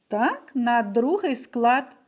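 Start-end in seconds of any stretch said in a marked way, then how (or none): none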